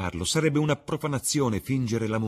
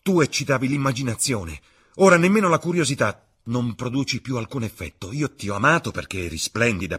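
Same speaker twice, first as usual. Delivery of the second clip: loud, high outburst of accusation; shouted; 6 semitones higher, 8 dB louder than usual. A narrator's reading. Dorian at the door accusing Sibyl, shouting. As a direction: hurl the accusation out at full voice. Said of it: "gridò".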